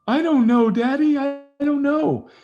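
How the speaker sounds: put-on very high, loud whiny teenage wail; crying daughter. Daughter answering her prying dad, high and wailing. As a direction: wail it like a heartbroken teen.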